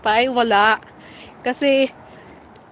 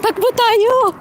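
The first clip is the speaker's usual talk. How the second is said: high excited call to run; excited shout; 11 semitones higher, 4 dB louder, slower than usual. Real, not acted. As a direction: call out eagerly while dashing off. Running across the street.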